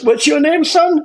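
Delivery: high, pressing question from the chief; gruff chief voice. Retold or imitated.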